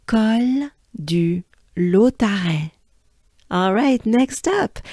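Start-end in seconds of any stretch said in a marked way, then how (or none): 0.0-2.7 "Col du Lautaret" slowly, in French; slow French model. Shown as a demonstration. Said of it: "let's take a good breath".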